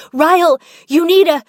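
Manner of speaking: sudden high, loud cry of alarm; Lily yells at Ryle to grab a pot holder, alarmed shout; urgent warning. Acted. Lily warns Ryle before he burns his hand, loud and high. Warning him about hot casserole.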